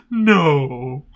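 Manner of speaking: drawn-out mock wail; half-hearted comic bawl; mock crying. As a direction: imitate a tearful, stretched-out no. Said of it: "you see Coach literally crying".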